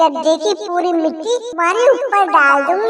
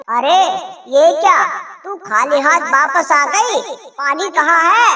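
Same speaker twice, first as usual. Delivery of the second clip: ordinary talk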